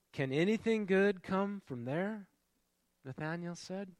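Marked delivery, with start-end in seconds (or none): 0.1-2.3 slow, doubtful question, higher; skeptical quote. Acted. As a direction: ask it like you already doubt the answer. Skeptic Nathanael voiced.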